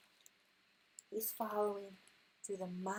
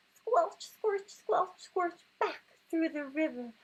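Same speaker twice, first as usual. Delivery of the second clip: much higher and louder, breathless dash; panicked rush; 7 semitones higher, 5 dB louder than usual. Acted. Reading the frantic escape to her son, high and breathless. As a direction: race through it in mock panic. Racing back home from bear.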